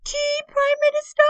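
very high, loud cutting-in; interrupting queen. Acted. Queen interrupting the Prime Minister: high, loud. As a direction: butt in sweetly.

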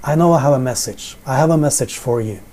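usual speaking voice

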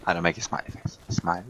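usual speaking voice

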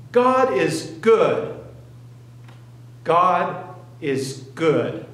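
emphatic declaration. Retold or imitated. Mother comforts weeping son.

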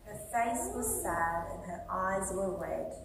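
usual speaking voice

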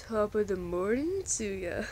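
a light put-on Irish-tinged greeting; lightly voiced Anti, chipper; playful character greeting. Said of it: "I'm Anti".

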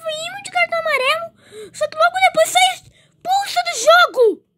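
half cry a complaint; whiny lament. Real, not acted. Player sent off.

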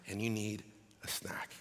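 breathy aside, voice dropped to a whisper. Pretend. Preacher whispering the sermon's refrain to the congregation, hushed. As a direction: lean in and whisper the punchline. Punchline whispered after repeated phrase.

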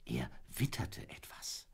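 whispering as the dog catches a scent; hushed whisper. A narrator's reading. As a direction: breathe it like a secret. Dog sniffs secret scent.

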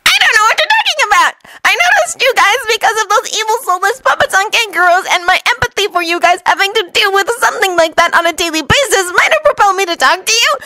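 high, overdone innocent protest; feigned innocence. Acted. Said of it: "Naruto feigned innocence".

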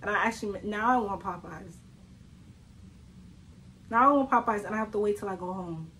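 usual speaking voice

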